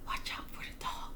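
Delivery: unvoiced, hushed warning; burglar whisper. A narrator's reading. Burglar warning his partner in a whisper. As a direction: whisper so the dog won't wake. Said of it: "said one of the burglars".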